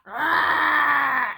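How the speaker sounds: big growling roar; bear roar. Shown as a demonstration. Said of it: "growl real loud".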